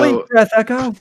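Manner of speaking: shouted high and loud, like calling a Pokemon; trainer battle call. Acted. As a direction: belt out the attack name.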